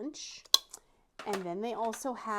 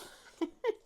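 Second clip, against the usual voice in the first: short high-pitched laugh; 14 semitones higher than usual. Real, not acted. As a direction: burst into a squeaky laugh. Laughs at own outrage.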